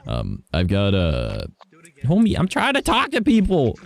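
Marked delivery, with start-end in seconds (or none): 2.0-3.8 high-pitched protest to someone interrupting; exasperated aside. Real, not acted. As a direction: plead to be left to talk, voice shooting up. Breaks off to scold someone nearby.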